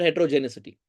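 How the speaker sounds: heterogeneity misread as "hetero-genicity"; common mispronunciation. Shown as a demonstration. Lecturer quotes what people call it, saying heterogeneity wrongly as "hetero-genicity". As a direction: slip an S sound into heterogeneity, "-genicity". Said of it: "But very commonly, people call it".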